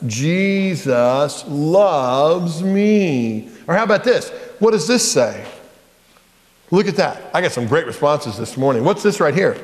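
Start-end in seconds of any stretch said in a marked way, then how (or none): 0.1-3.5 put-on drawn out for the kids to copy; slow leading chant; very slow demo. Shown as a demonstration. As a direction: stretch Jesus loves me out slowly. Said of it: "do it with me".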